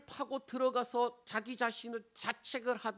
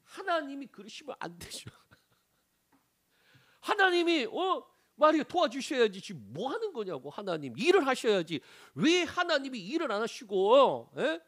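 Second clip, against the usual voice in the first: loud, higher, demanding protest at God; angry arguing with God; 5 semitones higher, 7 dB louder than usual. Retold or imitated. Believer loudly arguing with God over unanswered prayer. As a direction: confront God and demand he act. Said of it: "이제 막 따지기 시작하는 거예요".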